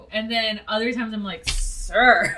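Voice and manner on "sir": loud, scandalized one-word reproach; mock reproach. Fan scolding the priest for his line, mock reproach. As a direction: call him out, playfully appalled.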